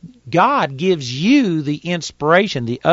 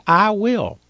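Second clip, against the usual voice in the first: "I will" lifted high, each word pressed; "I will" said higher and pressed, like a vow; stress on I will; 6 semitones higher than usual. Shown as a demonstration. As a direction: lean hard on "I will" as a firm decision. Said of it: "Notice he said".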